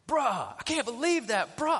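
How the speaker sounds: high, loud middle-schooler "bruh"; teen son voice. Retold or imitated. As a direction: mimic a teen's slangy exclamation.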